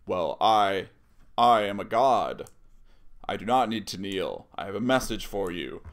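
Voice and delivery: loud, imitating a self-declared god; pompous proclamation. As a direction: declare it grandly and forcefully, mocking his self-importance. Acted self-declared god refusing to kneel.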